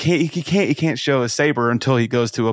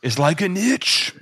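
higher, slow quoted villain line, rising at the end; Inquisitor impression; 7 semitones higher, slower than usual. Co-host echoing the Inquisitor's speech, mock-villain voice. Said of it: "Inquisitor talking, and he's like".

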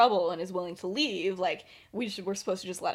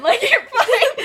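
laughing; 13 semitones higher, 11 dB louder, much slower than usual. Retold or imitated. Centaur dismissing James, laughing.

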